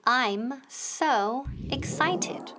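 slow, separated words for repeating; slow teacher's drill with gaps. Shown as a demonstration. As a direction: model "I'm", "so", "excited" one at a time.

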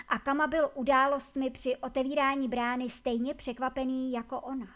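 plain reading voice

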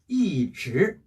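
"이" falling slowly, "즈" rising; slow demonstration of falling "이", rising "즈"; fourth tone then second. Shown as a demonstration. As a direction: drop on "이", then lift "즈". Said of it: "이 뒤에 이 성이".

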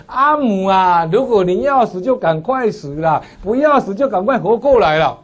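high, loud plea to mother, now in Mandarin; son-in-law's plea retold. Son-in-law urges his dead mother in Mandarin, high and loud. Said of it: "换成国语说".

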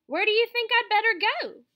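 put-on eager young pig. A narrator's reading. Nervous pig asks goose.

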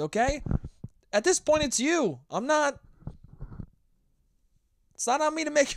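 high, loud, fed-up scolding; exasperated clown rant. Retold or imitated. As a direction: rant at the kid like an annoyed clown. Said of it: "I'm like".

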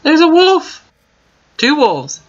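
high, loud alarmed cry; sudden high shout of alarm; excited shout. Real, not acted. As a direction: yelp it out on spotting danger. Spots a wolf in game.

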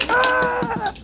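sharp high-pitched yelp of pain. Acted. Recap scene, sudden pain.

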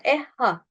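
"ehha" with no breath flowing on the h; quick Turkish-style "ehha"; clipped wrong h. Shown as a demonstration. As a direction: cut "ehha" into two short plain syllables. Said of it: "değil".

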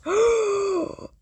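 sharp loud high gasp; shocked gasp. Real, not acted. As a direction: gasp at the reveal. Shocked reveal of suspect.

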